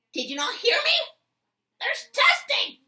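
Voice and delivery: teen imitating a teacher yelling at him, high and loud; teacher screaming. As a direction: scream it at the student like an outraged teacher. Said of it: "I'm tight that she screamed".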